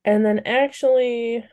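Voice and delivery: ordinary talk, nothing marked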